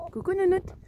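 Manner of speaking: petite voix attendrie pour les poules; voix aiguë affectueuse. Real, not acted. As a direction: parler aux poules comme à des bébés. Greets her hen. (voix de gazouillis affectueux)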